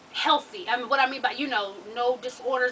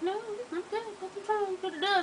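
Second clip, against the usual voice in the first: put-on high, soft little-girl voice; imitated child; 8 semitones higher, 6 dB quieter than usual. Child tells staff pressing her stomach she's fine, small voice. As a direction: pitch it up small and brush them off.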